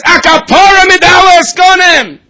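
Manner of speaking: high, loud, drawn-out cry; desperate shout. Retold or imitated. Father crying out to the rebbe, loud high shout. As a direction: yell it out high and slow.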